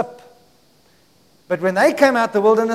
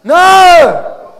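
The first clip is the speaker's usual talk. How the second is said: loud, high shouted NO; rallying preacher's shout; emphatic shout; 8 semitones higher, 14 dB louder than usual. Shown as a demonstration. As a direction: belt out a firm no for the church to copy. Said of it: "Come on, say it properly".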